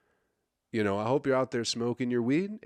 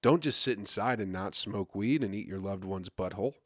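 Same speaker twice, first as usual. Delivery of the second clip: ordinary delivery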